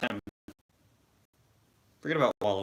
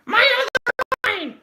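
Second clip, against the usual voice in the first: Mrs. Potato Head voice; 6 dB louder than usual. Retold or imitated. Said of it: "Missus Potato Head".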